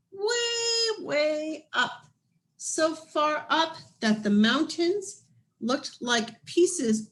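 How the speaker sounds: lively storybook reading voice; story narration. A narrator's reading. Reading a picture book aloud.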